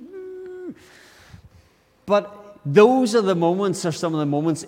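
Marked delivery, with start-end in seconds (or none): 0.0-0.7 exasperated hum. Retold or imitated. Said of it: "you're like".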